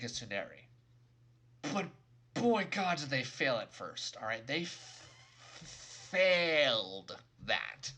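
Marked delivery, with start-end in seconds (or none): 4.4-7.9 "failed" drawn out very slowly and punched loud; stress on failed. Real, not acted. Reviewer ranting about how badly they botched it, heavy stress on "failed". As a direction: catch on the f, then hammer a long "failed". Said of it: "I cannot emphasize that word hard enough".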